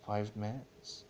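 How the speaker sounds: plain reading voice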